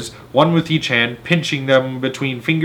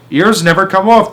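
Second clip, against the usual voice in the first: higher, louder dismissive shout; 7 semitones higher, 4 dB louder than usual. A narrator's reading. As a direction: shout her down. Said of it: "the Trunchbull shouted".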